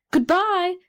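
high, lightly called-out goodbye; storybook cry, pitched well up; cried farewell. A narrator's reading. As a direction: call it out like someone floating away. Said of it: "cried the fire balloon".

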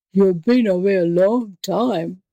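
menacing savage tiger voice; growling tiger. A narrator's reading. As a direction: growl it like a hungry beast. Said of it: "growled the savage beast".

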